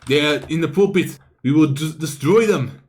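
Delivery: put-on rally the troops in an improvised Dynasty accent; hammed-up Dynasty accent, monk giving orders; made-up dynasty accent. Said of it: "how do I do a dynasty accent".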